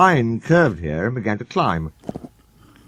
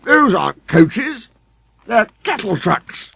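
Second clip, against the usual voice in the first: disdainful sneer; 11 semitones higher, 4 dB louder, slower than usual. A narrator's reading. Sir Handel insulting coaches.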